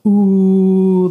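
loud drawn-out mocking ooh; teasing ooh. Coworker teasing her in the office, loud mocking ooh. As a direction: egg it on like a schoolyard tease. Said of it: "another coworker's like".

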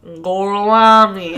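loud, drawn-out Tennessee mangling of Italian; thick Tennessee accent. Retold or imitated. Host imitating the character repeating his fake name, Tennessee drawl. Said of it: "with his thick Tennessee accent".

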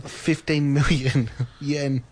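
laughing. Real, not acted. Laughing at an absurd price.

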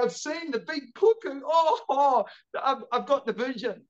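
hammed-up childish anguish, easing off at the end; mock horror. Child self horrified at sight.

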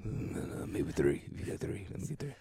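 sneaky, hushed request; whisper. Acted. Customer upping the order to two pizzas, whispered. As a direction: ask under the breath, furtively. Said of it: "like, whisper".

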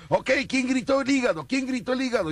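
high calling-out host; hyped fairground barker. Retold or imitated. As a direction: shout to the crowd, high. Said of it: "el conductor del evento dijo".

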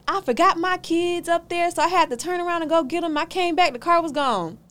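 lightly mimicked whiny excuse; mocking her excuse. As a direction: mimic the renter's flimsy story. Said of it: "this was her excuse".

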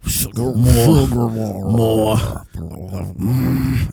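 slow, halting alien bug voice; Bug impression. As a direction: drag each word out like a bug in a human body.

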